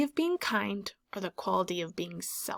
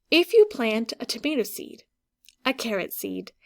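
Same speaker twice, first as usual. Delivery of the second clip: put-on storybook read to children, hushed in places; story reading. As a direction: read it like a bedtime story to a class. Teacher reads picture book aloud.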